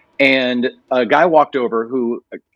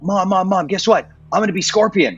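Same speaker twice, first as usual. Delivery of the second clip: higher, eager rush of news; bursting to tell mom the news; excited to mom; 7 semitones higher than usual. Retold or imitated. Telling his mom he got the part, excited and higher.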